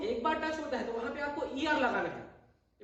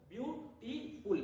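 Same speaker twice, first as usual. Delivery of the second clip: Beautiful slowed to show each syllable; beautiful said slowly and clearly syllable by syllable; slow syllable demo; 5 dB quieter, much slower than usual. Shown as a demonstration. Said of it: "तो दो बार touch हो रहा है". (Beautiful धीरे-धीरे शब्दांश गिनते हुए)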